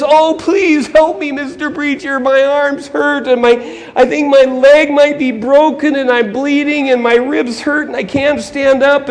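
high, slow, pained begging; desperate acted victim's plea; pleading injured man. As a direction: beg for help through the pain.

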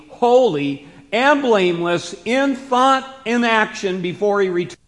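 loud, high, punched-out phrases; emphatic, driving pulpit delivery; preaching climax. Real, not acted. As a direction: build to a forceful declaration. Preacher builds to climax of paraphrase.

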